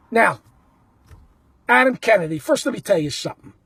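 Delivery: normal talking voice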